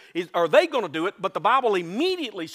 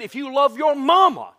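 pleading call; 6 semitones higher, 7 dB louder than usual. Retold or imitated. Mock altar call plea.

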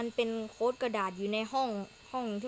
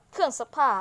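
surprised; 5 semitones higher, 10 dB louder than usual. Real, not acted. Player finds washing machine in game.

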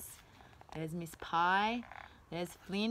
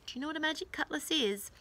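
quick hushed question; 5 semitones higher, 4 dB quieter, much faster than usual. Acted. Quiz to children.

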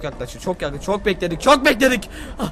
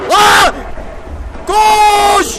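panicked shouts, much higher and louder; terrified scream; 11 semitones higher, 9 dB louder, much slower than usual. Real, not acted. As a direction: scream as the monster appears. Monster appears, screaming.

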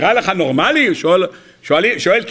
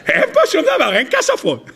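laughing; 9 semitones higher than usual. Acted. Laughing while voicing the tanna.

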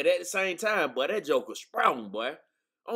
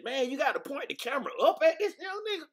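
much higher, mock amazed outcry; amazed retelling; 11 semitones higher than usual. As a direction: exclaim in disbelief at his size. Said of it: "I said".

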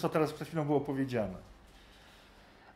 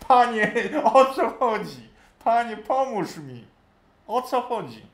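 slow pleading seeker; helpless plea; 5 semitones higher, 5 dB louder than usual. Retold or imitated. Confused reader begging the Lord, slow helpless plea. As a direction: beg for understanding, drawn out. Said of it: "Nie rozumiesz?".

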